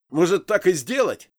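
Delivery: loud, high, sudden threat; abrupt menacing challenge; sharp question. A narrator's reading. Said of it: "резко спросил меня первый".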